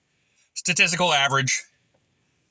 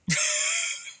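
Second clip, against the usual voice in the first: breathy high giggle; a quick airless laugh; airy laugh; 4 dB quieter than usual. Real, not acted. Laugh after drunk bit.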